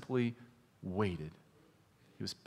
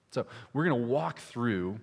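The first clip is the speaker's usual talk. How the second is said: ordinary delivery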